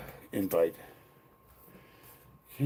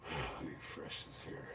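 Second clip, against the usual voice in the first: whispering; 8 semitones lower, 21 dB quieter than usual. Real, not acted. Mutters to himself at screen.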